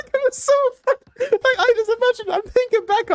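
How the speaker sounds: giggling as you speak; laughing. Real, not acted. Remembering the teacher row, laughing.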